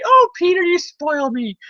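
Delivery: put-on very high old-aunt falsetto; doting Aunt May. Aunt May thanks nephew Peter from hospital, high mock-doting voice.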